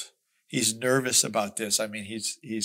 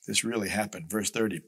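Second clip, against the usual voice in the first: ordinary talk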